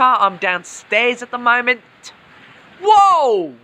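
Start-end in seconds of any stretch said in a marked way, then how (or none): 2.8-3.5 sudden high startled cry; jolted by a thunderclap; startled whoa. Real, not acted. Lightning strike close by.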